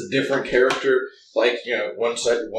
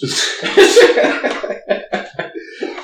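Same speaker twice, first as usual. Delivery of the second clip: laugh; 6 dB louder than usual. Real, not acted. Laughing at hating role-play.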